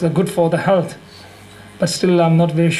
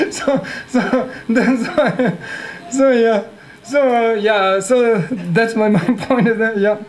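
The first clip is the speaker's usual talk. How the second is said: laughing; 3 semitones higher, slower than usual. Real, not acted. Laughing after the punchline.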